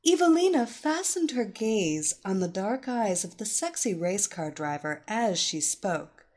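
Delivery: usual speaking voice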